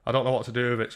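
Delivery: usual speaking voice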